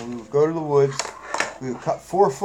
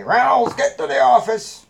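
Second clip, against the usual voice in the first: high, loud bellowed summons; mock summoning shout; 10 semitones higher, 6 dB louder than usual. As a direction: imitate a principal hollering for a student. School summons him over intercom.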